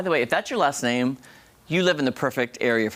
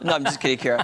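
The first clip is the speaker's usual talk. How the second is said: ordinary talk, nothing marked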